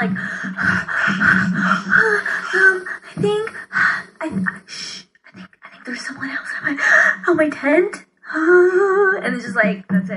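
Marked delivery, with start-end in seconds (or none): 0.2-3.0 sharp scared gasp; frightened gasp. Shown as a demonstration. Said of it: "breathe a lot". 3.1-8.0 hushed, fast frightened whisper; scared whisper. Acted. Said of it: "You would, like". 8.3-9.2 loud scared gasp; frightened gasp. Acted. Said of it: "breathe a lot".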